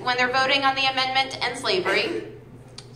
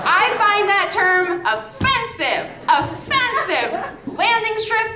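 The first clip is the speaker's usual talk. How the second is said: mock offended feminist; 6 semitones higher, much slower than usual. Comic plays easily offended feminist, mock indignant. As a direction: play the offended activist, drawn out. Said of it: "I'm a feminist and a proud one".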